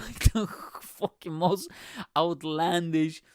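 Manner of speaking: usual speaking voice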